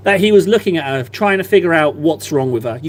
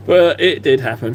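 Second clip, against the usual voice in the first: ordinary talk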